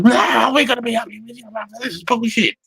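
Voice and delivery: high, shouted protest of a panicky officer; whiny officer. Retold or imitated. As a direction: mimic a rattled officer yelling up high. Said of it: "Then he goes".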